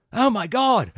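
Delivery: high, loud mock Australian gasp; a badly faked Australian "Oh my God"; bad Australian accent. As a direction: squeal it like a shocked Aussie tourist. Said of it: "a horrible accent".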